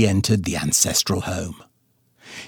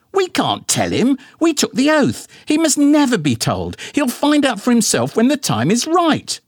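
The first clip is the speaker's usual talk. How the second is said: high-pitched, huffy mother voice; indignant mama; 14 semitones higher than usual. A narrator's reading. Mama bickering with daddy about his bald spot, high and huffy. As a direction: sniff it out, much higher, prim and offended.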